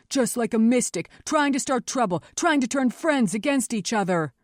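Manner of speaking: snarled accusation. A narrator's reading. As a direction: sneer accusingly at a troublemaker. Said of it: "he snarled".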